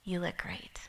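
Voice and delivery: low whispered husband's reply; hushed, barely voiced answer; mild husband whisper; flat whispered reply. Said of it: "He goes".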